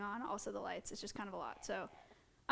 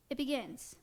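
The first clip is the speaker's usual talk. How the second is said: normal talking voice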